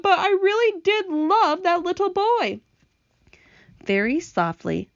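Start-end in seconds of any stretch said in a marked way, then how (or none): 0.0-2.6 high, wailing confession of love; mock sobbing. Earthworm mourns the little boy, acted sobbing wail. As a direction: pitch it high, a hammed-up tearful wail. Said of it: "the earthworm sobbed".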